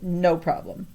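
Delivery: plain reading voice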